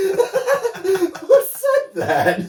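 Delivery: high, drawn-out words squeezed through laughter; laughing helplessly. Real, not acted. Host asking who gave the absurd quote, laughing helplessly. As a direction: gasp the question out mid laughing fit. Asks while still laughing hard.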